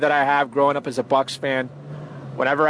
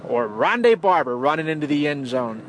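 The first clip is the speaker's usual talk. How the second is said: ordinary delivery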